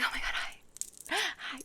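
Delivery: breathless whispered then squeaky high hi. Retold or imitated. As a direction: gush like an over-eager flirt. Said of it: "I don't go out being like".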